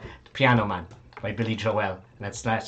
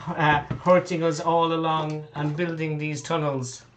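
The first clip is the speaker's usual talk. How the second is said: put-on higher, aggrieved child's voice; lava child; 5 semitones higher, 4 dB louder than usual. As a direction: denounce the villain as an upset child. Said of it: "it's one of the lava children".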